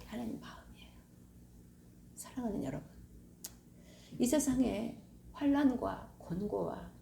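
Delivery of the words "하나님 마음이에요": soft unvoiced whisper; hushed reverent whisper. Real, not acted. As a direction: breathe the words. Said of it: "whispered climax".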